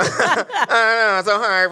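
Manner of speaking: lightly mimicked British whine; whiny British voice. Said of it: "That's how British people sound to me".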